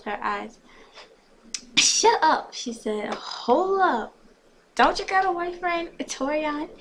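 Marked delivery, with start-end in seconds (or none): 1.8-2.5 high, loud shut up; sharp high-pitched outburst; excited retort. A narrator's reading. 3.1-6.7 high, loud questioning; raised pitch, drawn-out hold up; incredulous challenge. A narrator's reading. Acted challenge to friend.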